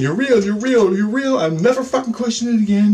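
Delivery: high, repeated desperate plea; begging. Retold or imitated. Conceding to the alien that it is real, begging. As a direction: beg insistently, pitch climbing. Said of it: "And I'm begging".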